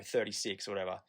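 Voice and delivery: usual speaking voice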